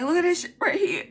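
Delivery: high, loud, fast urgent plea; frantic pointing. Retold or imitated. Showing her partner the web, loud frantic high plea. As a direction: push him to look, panicked.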